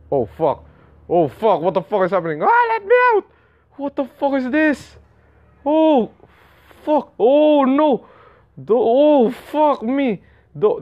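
high, loud panicked yelling; real panic. Real, not acted. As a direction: cry out in alarm at a game scare. Jump scare in the horror game.

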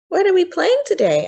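high, loud, excited question; eager pupil. A narrator's reading. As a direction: ask like a kid itching to play. Eager pupil asks the coach.

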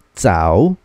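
"cao" long, dipping low then rising; third tone. Shown as a demonstration. Teacher drilling learners in third tone, "cao" dipping and rising. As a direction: sink down on "cao" and climb back up. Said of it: "how would we read this third tone".

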